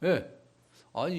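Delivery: ordinary delivery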